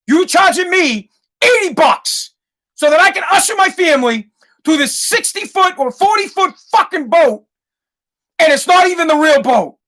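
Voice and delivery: high-pitched, indignant rant; pissed. Retold or imitated. Customer complaining about paying for a replica boat, pissed. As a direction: protest like a cheated customer, pitch way up.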